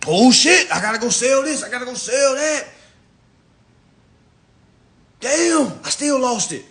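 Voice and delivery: high, loud mock panic; panicked loser. Mocking a client who lost it all, in a high, loud wail. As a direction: wail it like a man losing everything.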